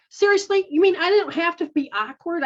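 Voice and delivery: high, loud, amazed little one; a child who can't believe it; incredulous child voice. Retold or imitated. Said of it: "your little one's like".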